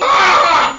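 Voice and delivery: high, loud cartoon scream; mock scream. Storyteller acts Yusupov under attack, mock scream. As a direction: yelp out in hammed-up alarm.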